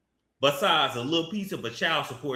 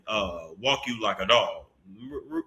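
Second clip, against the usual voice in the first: usual speaking voice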